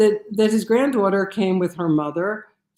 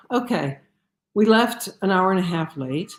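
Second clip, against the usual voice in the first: ordinary delivery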